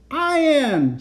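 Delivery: high, drawn-out call; robot's insistent claim. A narrator's reading. Next robot Victor claiming to be real, insistent call. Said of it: "said the one next to him".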